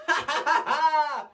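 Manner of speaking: high maniacal cackle; shrill villain laugh; Joker cackle. Retold or imitated. Joker laughing at Batman, high cackle. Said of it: "Mark Hamill".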